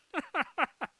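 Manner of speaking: short high laugh. Real, not acted. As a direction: burst out laughing. Laughs at own joke.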